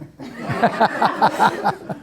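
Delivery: long, high run of laughter; hearty laugh. Real, not acted. Teacher amused by student's attempt, laughing heartily.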